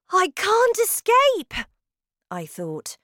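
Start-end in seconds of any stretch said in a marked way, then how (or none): 0.1-1.5 high, loud frightened voice; trapped child's panic; panicked boy. A narrator's reading. Trapped boy realises his danger, high panic.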